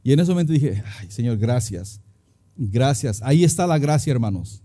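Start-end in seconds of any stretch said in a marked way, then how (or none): 1.0-3.2 lower, slow sigh of thanks; relieved gratitude. Retold or imitated. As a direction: exhale your thanks to God, reliving the relief. Retold relieved thanks to God. (agradecido y aliviado, grave y despacio)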